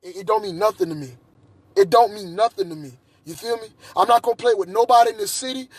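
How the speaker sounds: ordinary delivery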